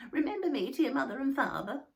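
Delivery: put-on high, loud creaky old worm; elderly earthworm. A narrator's reading. Old earthworm sending regards to Moomin's parents, high-pitched. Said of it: "said the worm".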